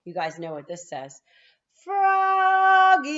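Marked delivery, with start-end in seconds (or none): 1.8-3.1 much higher, much louder long yell; coach yelling. A narrator's reading. Coach yelling to Froggy in the outfield. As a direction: call him across the field. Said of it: "yelled the coach".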